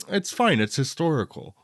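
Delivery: imitating a shrugging studio exec; breezy dismissal. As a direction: toss it off like nothing matters. Mock quote of lenient studios.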